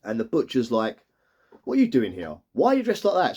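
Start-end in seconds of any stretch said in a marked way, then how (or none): 1.6-3.4 mock shock, leaping high on the question; retold startled questioning; baffled husband, voice jumping up. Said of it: "like, really shocked to see her".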